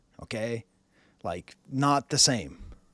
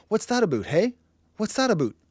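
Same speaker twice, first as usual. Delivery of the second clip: higher-pitched mock Canadian; hammed-up Canadian accent, pitched up; 8 semitones higher, 4 dB louder than usual. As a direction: caricature a Canadian with oo in "about". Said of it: "with a heavy Canadian accent".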